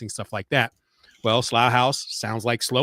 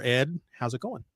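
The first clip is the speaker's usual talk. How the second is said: ordinary delivery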